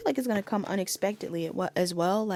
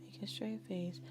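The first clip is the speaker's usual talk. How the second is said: hushed, breathy half-whisper, much softer; soft whispered lyrics; 13 dB quieter than usual. Acted. Reader whispers Paramore lyrics to her viewers, hushed. Softly reading song lyrics aloud.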